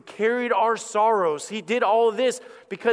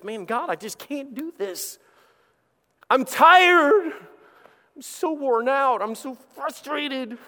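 weary complaint; 6 semitones higher, slower than usual. Retold or imitated. Voicing an exhausted struggling person.

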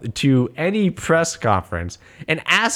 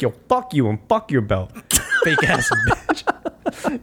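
laughing mock insult. Reporter taunting the fighter, cracking up mid-insult. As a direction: insult dissolving into a slow laugh. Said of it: "And they're like".

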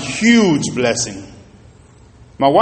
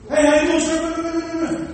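higher, bright mock greeting; imitating eager salesman; 12 semitones higher, slower than usual. Pastor mimicking the salesman greeting another customer, eager. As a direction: overdo the friendly hello. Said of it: "This dude".

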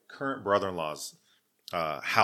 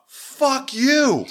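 much higher, louder mock snarl; drunk insult; 10 dB louder than usual. Drunk girlfriend flipping him off, high loud mock snarl. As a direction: spit it out like a drunk. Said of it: "flips me off".

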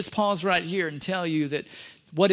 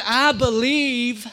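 slow, higher, louder plea; crying out; 5 semitones higher, 6 dB louder, much slower than usual. Acted. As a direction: cry it out, desperate. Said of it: "The father instantly cried".

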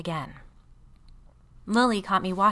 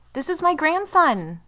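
proud grandmother, slower and higher; glowing with pride, slow and louder; beaming; 11 semitones higher, 9 dB louder, slower than usual. A narrator's reading. Dead grandmother to the barista's visitor, beaming with pride.

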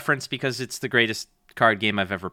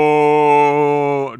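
mock Vader no; 7 dB louder than usual. Imitating the long Vader no.

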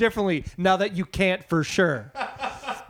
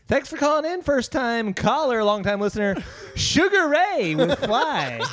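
radio DJ voice; 7 semitones higher than usual. Pretend. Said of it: "DJ responds to caller".

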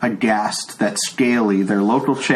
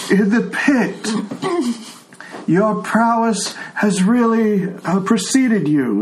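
put-on high, creaky old man voice; 9 semitones higher, slower than usual. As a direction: play a deaf old man. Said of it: "he says".

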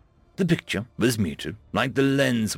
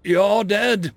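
higher, louder stunned question; 8 semitones higher, 5 dB louder than usual. A narrator's reading. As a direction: blurt it out in shock. General stunned by the resurrection.

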